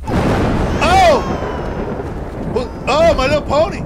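loud, high outburst of surprise; yelp, then exclaim loudly; startled yelp. Real, not acted. Player startled by a toy, loud surprised cry.

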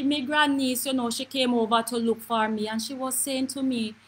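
ordinary delivery

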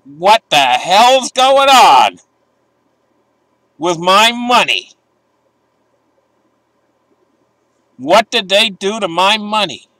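mock the panic of someone losing savings; outraged Russian saver. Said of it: "you're gonna be like".

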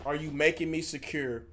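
mock her question in a low, dismissive mumble; mocking quote. Mocking her line from clip.

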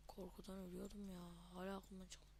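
hushed whisper. Real, not acted. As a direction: whisper it low. Whispering aside to viewers.

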